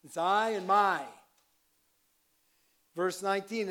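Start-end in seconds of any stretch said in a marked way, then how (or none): 0.0-1.0 loud, raised punch on "I" and "my"; stress on I, my. Shown as a demonstration. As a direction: hammer both "I" and "my". Sums up the accented words.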